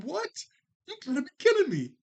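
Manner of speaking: high, loud mock outrage, fast; shocked disbelief. Chucky sees his crush chase Tommy, high shocked yelp. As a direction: squeal it like you can't believe it. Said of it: "Chucky's like".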